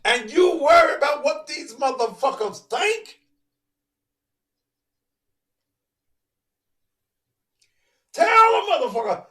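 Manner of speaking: high, loud preacher build; preaching shout. Acted. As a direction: rail at the congregation at full force.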